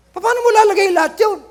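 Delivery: can't believe what the nurse just said; shocked disbelief. Retold or imitated. Retelling his shock at the nurse's patch count, incredulous cry.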